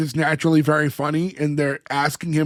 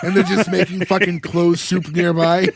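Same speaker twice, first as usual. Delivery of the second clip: ordinary talk, nothing marked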